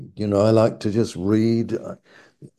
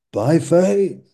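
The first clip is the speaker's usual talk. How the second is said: "by faith" raised high on "faith", then falling; stress on faith; 5 semitones higher, 3 dB louder than usual. Shown as a demonstration. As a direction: make "faith" the peak. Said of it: "He's emphasizing faith".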